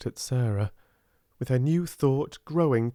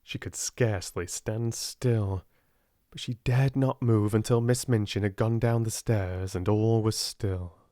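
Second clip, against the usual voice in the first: ordinary delivery, nothing marked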